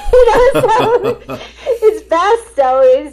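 real laughter breaking through the words; laughing. Real, not acted. Laughing at her own awkward fan mix-up story.

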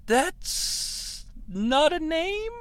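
"That's not a name" drawn out slowly, climbing high on "name"; perplexed, soft and stretched, "name" swooping up like a question; questioning rise on statement. Retold or imitated. Alex perplexed by her answer, "name" rising into a question. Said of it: "With a question mark on the end".

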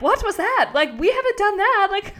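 high-pitched put-on voice of protest; indignant talking leg. Leg complaining to its owner about the sprint, indignant. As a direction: squeal it like an affronted body part. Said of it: "my whole leg was like".

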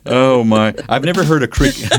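laughing. Real, not acted. Amused reaction.

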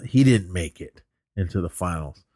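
normal talking voice

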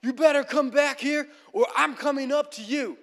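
high-pitched threatening yell; angry hiker yell. Retold or imitated. Angry hiker threatening the hikers uphill, irate yell. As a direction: imitate a furious man calling uphill. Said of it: "we keep hearing him yell".

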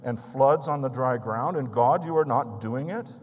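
loud, high, pleading cry of challenge; elder crying out to God at a farmhouse prayer meeting, loud and pleading. Retold or imitated. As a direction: call out to God as if his honour is at stake. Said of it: "he cried".

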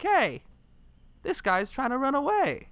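high-pitched mock alarm; retold outraged ladies. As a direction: call out high, imitating indignant women. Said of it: "The ladies are like".

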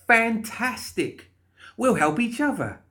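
very high, bubbly exclamation; gleeful delight. Acted. Molly clapping at the guard's surrender, delighted. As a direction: stretch the first word with glee.

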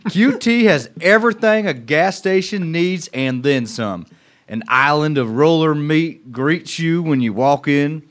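put-on Southern accent, proud and brash. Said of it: "good Southern accent".

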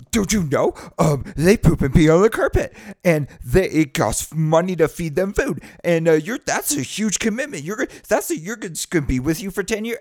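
high, loud, fussy alarm over the puppy; hammed-up worried friend listing the downsides; mock alarmed warner. Imitating the friend warning about the dog, high and alarmed. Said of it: "I got was like".

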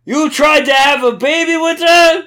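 high, loud, slow hammed-up rage; mock rage at Espio; furious shout. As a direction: roar it like a jealous cartoon hothead. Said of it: "next level of his anger".

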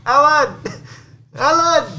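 high loud shout across a crowd; calling out. Retold or imitated. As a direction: yell the name to get his attention.

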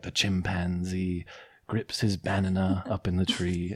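low, slow documentary narrator; nature narrator. Pretend. Joking to friends about odd stress, mock nature narrator. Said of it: "always sounds like a, a nature narrator".

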